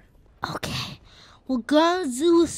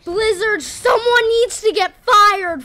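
loud, higher, slow rant; mock outrage; 8 semitones higher, 13 dB louder than usual. Real, not acted. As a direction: call out the developer. Ranting about game glitch.

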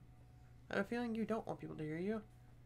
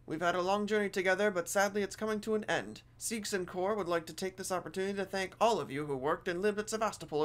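louder, formal public announcement read; announcer voice; 8 dB louder than usual. Acted. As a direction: read it like a corporate PA notice. Reads station announcement aloud.

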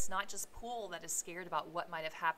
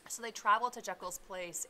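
ordinary delivery, nothing marked

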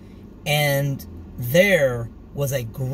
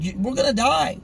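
1 panicked disciples; 9 semitones higher, 3 dB louder than usual. Acted. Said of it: "And they're like".

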